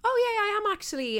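high, light, bright-eyed reply; chirpy high answer to mum's question; breezy reply. Retold or imitated. Imitating her own high breezy answer to mum. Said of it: "And I was like".

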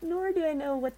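anxious about what comes next; freaking out. Real, not acted. Reader confessing to listeners she's nervous, high pitched. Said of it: "I'm kind of freaking out".

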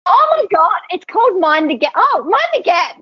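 squealing answer about her podcast; flustered excitement. Real, not acted. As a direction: blurt it out, caught off guard and thrilled. Flustered at podcast name question.

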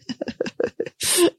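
soft breathy giggle; quiet high chuckle; amused laugh. Real, not acted. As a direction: giggle at your own story. Laughs while retelling crying.

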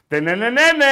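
loud, high vocal horn blare; annoying horn imitation. Host showing co-host the village-ground horn, loud honking imitation. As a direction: honk like an irritating stadium horn. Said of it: "ο ορισμός της ενοχλητικής κόρνας".

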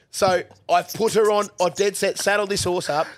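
usual speaking voice

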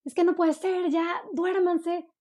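high, drawn-out scolding at bedtime; fed-up mother ordering kids to sleep; exasperated mom yelling. Retold or imitated. Mom losing patience at bedtime, yelling slowly. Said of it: "a mí me pasa que empiezo".

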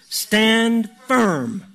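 "stand firm" punched high and loud, each word drawn out; emphasized. Real, not acted. Preacher stressing the neglected heart of the passage, emphatic. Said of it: "I'm gonna emphasize the word, a word in here, a statement in here".

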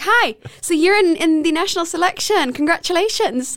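high, bright phone caller voice; chirpy official congratulating; cheerful caller. Retold or imitated. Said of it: "they were like".

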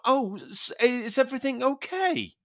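mocking the instructor's sudden niceness; mock sweet concern. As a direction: put on sudden sugary concern. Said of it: "completely changed".

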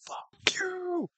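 high, strained swearing; angry yell. Retold or imitated. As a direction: scream the curse up high. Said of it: "He just goes".

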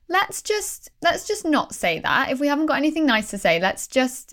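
put-on talking to a child. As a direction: coax your inner child kindly. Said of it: "like I'm a child".

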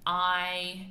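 usual speaking voice

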